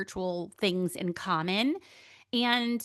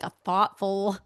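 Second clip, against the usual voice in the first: usual speaking voice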